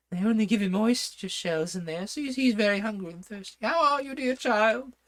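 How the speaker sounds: the King cooing over Hatta; fussy King. Acted.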